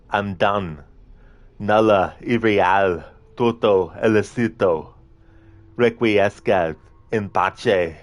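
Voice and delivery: hammed-up Italian-accented hero lines, slow and dramatic; earnest Italian accent, slow on the Latin; Italian accent impression. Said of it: "doing an impression of someone with an accent from a different country".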